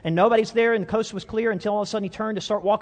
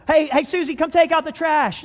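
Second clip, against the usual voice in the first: mom calling out; 10 semitones higher, 6 dB louder than usual. Acted. Mom calling Susie, higher, louder.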